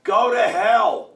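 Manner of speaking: loud, slow mock street yell; yelled insult. New Yorker yelling at Tevye on Hester Street, loud slow yell. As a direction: bellow it like a rude passerby. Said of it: "this New Yorker yells out".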